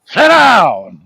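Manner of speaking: high, drawn-out bellow; mock shouted order. Courtroom order to the witness, imitated in a high mock shout. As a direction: bark it out high.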